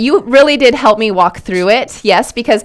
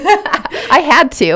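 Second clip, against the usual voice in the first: laughing; 5 semitones higher, much slower than usual. Real, not acted. Laughing at own drink pick.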